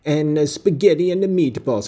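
mock Italian-American accent while listing dishes. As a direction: lay on a corny Italian-American accent for the food. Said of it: "I know I'm just saying American, Italian-American dishes".

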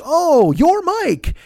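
high, drawn-out happy recognition; mock-gushing, stretched and high-pitched; delighted greeting. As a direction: imitate her gushing hello. Acting her delighted greeting on meeting.

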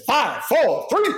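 a hint of a stage manager calling it; lightly voiced countdown; imitating a stage manager's countdown. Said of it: "in his infamous voice said".

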